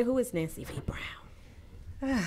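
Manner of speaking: hushed, slowed teaser question; dramatic whisper. Acted. Host teasing the guest's introduction in a slow whisper. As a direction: breathe the question like a secret reveal.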